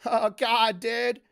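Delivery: loud lazy stoner exclamation. Retold or imitated. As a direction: California stoner, half laughing. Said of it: "do your stoner voice".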